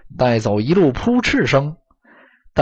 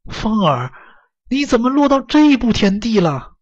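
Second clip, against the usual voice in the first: high, pained voice of a worried mother; heartbroken mother; 9 semitones higher than usual. A narrator's reading. Mother looks over her ragged son, heartbroken. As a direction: ache for your child, pitch lifted high. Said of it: "心痛道".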